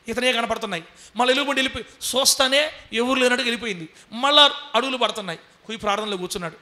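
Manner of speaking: usual speaking voice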